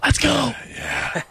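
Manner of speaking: high hushed hype shout; whisper yelling. Retold or imitated. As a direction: yell it under your breath, pumped. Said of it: "he whisper yells".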